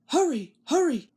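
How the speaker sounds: lightly called hurry; calling out. A narrator's reading. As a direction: call back to the trucks. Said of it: "called Thomas".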